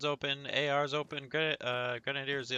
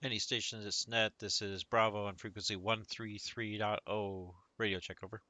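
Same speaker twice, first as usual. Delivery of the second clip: crisp radio check; 3 semitones lower, 15 dB louder than usual. Acted. Squad radio check on net.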